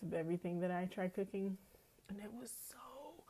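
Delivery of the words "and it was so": breathy hushed whisper. Real, not acted. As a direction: whisper it like a secret treat. Savoring tart.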